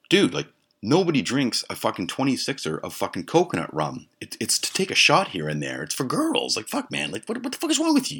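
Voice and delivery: high-pitched, incredulous telling-off; exasperated rant. Retold or imitated. Griping at his friend about coconut rum, exasperated rant. Retold quarrel over the rum.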